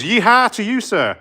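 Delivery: high, hammed-up American yeehaw; American accent. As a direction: greet them like a whooping American cowboy. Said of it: "is that an American accent".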